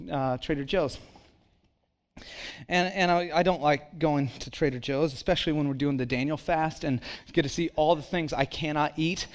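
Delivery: normal talking voice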